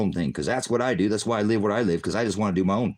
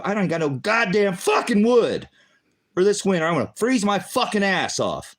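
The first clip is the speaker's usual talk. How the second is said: venting real fury at being left without wood; angry rant; 10 semitones higher, 4 dB louder than usual. Real, not acted. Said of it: "listen to my rant".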